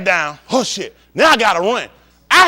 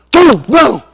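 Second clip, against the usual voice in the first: shouted sound effect booms; 1 loud boom; 4 semitones higher, 10 dB louder than usual. Acted. Comic acting out the crime, loud booming. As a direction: bang it out loud, like a blast.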